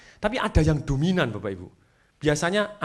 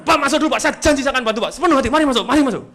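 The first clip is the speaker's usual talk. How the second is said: raised, higher, urgent welcome; forceful but reassuring; 8 semitones higher, 6 dB louder, faster than usual. Shown as a demonstration. Employee inviting an angry customer in, forceful and earnest. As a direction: demonstrate loud words meant to help. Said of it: "Kata-katanya boleh keras".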